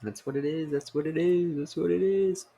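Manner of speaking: playful sing-song climb; rising sing-song. Acted. Repeats phrase, climbing.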